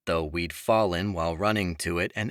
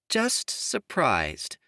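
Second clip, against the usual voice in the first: measured; 7 semitones higher, much slower than usual. A narrator's reading. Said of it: "Imogen said fairly".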